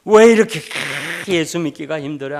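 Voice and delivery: high, loud anguished cry fading off; a retold sob that softens at the end; wailing cry. Deaconess weeping before the pastor, fading cry. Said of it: "통곡의 눈물을 흘린 거예요".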